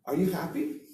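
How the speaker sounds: "happy" climbing high at the end; rising question intonation. Shown as a demonstration. Teacher showing how we ask a question, rising intonation. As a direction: lift the pitch right up on "happy". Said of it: "going up".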